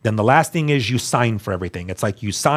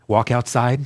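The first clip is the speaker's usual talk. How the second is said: normal talking voice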